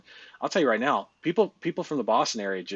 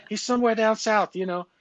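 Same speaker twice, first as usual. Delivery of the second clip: high-pitched, louder attempt at a Boston accent; 10 semitones higher, 4 dB louder than usual. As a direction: put on a hammed-up Boston accent. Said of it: "that's the best Boston accent I got".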